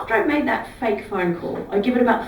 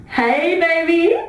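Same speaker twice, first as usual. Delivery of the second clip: higher, louder sing-song hello to a lover; bright fake greeting; 7 semitones higher, 4 dB louder, much slower than usual. To an imaginary partner on the bus, a loud showy hello.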